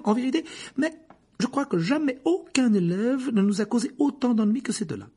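a hint of a high stern teacher; teacher remembering two pupils, lightly voiced. A narrator's reading. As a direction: recall the troublemakers with mild exasperation.

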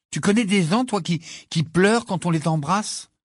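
high-pitched, incredulous question; indignant disbelief. Acted. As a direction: ask as if it were absurd. Ron protests, baffled and indignant. (incrédule, voix aiguë)

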